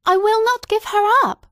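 mother refuses the magistrates, almost shrieking; near shriek. A narrator's reading. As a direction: let the voice climb nearly to a shriek. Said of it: "raising her voice almost to a shriek".

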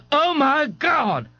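high, slow shriek of alarm; scream. Acted. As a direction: scream it in panic. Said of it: "Ignatius screamed".

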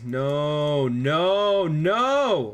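real dismay as the game turns; rising frustrated no. Real, not acted. Leader wounded, game going badly.

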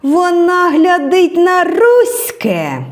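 high, drawn-out cry of alarm; a soldier suddenly jolts as if stung; startled alarm. A narrator's reading. Policeman startled that Tereza looks toward the Russians, slow alarmed high cry. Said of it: "раптом жахнувся один".

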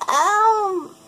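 lightly voiced [name] starting her rant. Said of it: "[name] ... talking about Superman".

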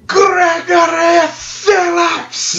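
high, drawn-out hollered self-introduction; shouted proclamation. Acted. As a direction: proclaim your name slowly up to the window.

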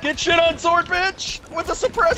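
high-pitched victory crowing; real excited trash talk; gloating taunt. Real, not acted. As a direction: crow over the kill, voice way up. Gloats after a kill.

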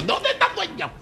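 shrill high-pitched shout for the owner; mock shrill demand. Customer demanding the owner, shrill squeal.

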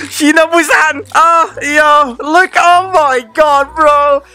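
high, drawn-out cries of awe; thrilled amazement. Real, not acted. Fan seeing Shinobu out of the box, thrilled cries. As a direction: cry out high in delighted awe. First sight of Shinobu figure.

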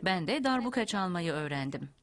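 ordinary talk

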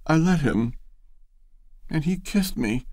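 blurted out. A narrator's reading. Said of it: "then blurted out".

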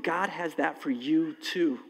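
ordinary talk, nothing marked

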